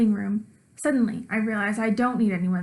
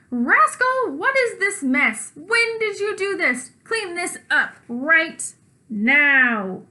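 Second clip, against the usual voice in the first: high, loud, slow scolding; angry mom; 7 semitones higher, 5 dB louder, much slower than usual. Acted. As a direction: scold the kid for the mess. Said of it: "Then I hear screaming".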